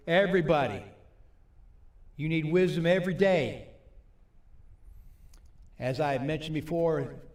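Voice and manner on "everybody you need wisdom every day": loud, high and emphatic; rousing preacher's call. Real, not acted. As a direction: drive it home to the whole congregation. Preacher rousing an unresponsive congregation.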